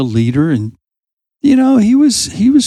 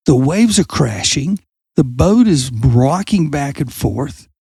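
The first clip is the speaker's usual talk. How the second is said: ordinary delivery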